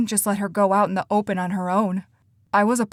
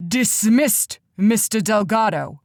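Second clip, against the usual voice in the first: a touch louder, commanding; mild booming dismissal; 4 dB louder, slower than usual. A narrator's reading. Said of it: "Hector said in a booming voice".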